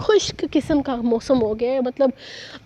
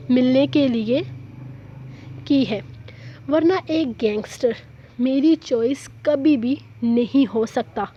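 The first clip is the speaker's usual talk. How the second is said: novel reading. A narrator's reading. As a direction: read the episode like an audiobook narrator, unhurried. Reads novel episode aloud.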